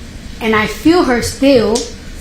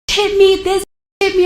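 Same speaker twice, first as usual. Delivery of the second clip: high, shrill-free mock accusation, pitched way up; mimicking frantic accusation; 8 semitones higher than usual. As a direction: imitate the receptionist crying foul. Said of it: "she started wilding out".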